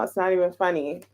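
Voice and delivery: ordinary delivery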